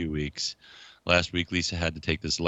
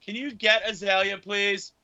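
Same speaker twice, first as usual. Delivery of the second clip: high-pitched holler to someone in another room; yelling at wife; 13 semitones higher than usual. Real, not acted. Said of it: "I have to go yell at my wife".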